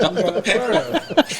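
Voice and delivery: slow laughing tease; laughing slowly. Real, not acted. Teasing echo.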